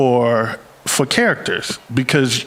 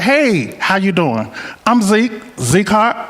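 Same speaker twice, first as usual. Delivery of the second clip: higher, friendly Southern drawl on the fake name; Southern accent; 6 semitones higher, slower than usual. Shown as a demonstration. Southern accent introducing his made-up persona to a stranger. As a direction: lay the Southern accent on thick as a charming stranger. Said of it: "a Southern accent".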